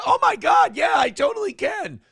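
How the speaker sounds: high, loud, overexcited shouting; mock gushing excitement. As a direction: squeal it like a thrilled pizza guy. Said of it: "when he came in and goes".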